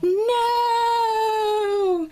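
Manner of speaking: long, high, loud mock wail; son's wailed protest. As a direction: imitate a kid's drawn-out cry of horror. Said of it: "all you hear my son go is".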